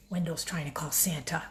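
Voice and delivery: secretive whispered aside. Real, not acted. Reader letting children in on the joke, whispered. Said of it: "whispered aside".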